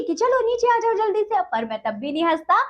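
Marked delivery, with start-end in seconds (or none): 0.1-1.4 higher, a playful mother's mild scold; mock scolding mother. Mother scolding her son to climb down, mildly mock-angry. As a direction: pretend to scold a child down from a tree. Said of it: "गुस्सा होकर तुम मुझे डांटती".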